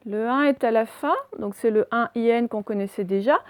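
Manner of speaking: ordinary delivery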